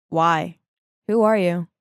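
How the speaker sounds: low, wary questioning; skeptical. Acted. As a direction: answer a stranger with guarded suspicion. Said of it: "gave me a skeptic look, then replied".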